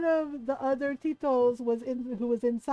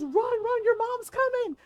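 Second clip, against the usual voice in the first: mock-panicked retold warning, higher pitched; urgent warning; 10 semitones higher, 4 dB louder than usual. Cousin warns everyone that mom's coming, mock-urgent. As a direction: imitate a cousin sounding the alarm.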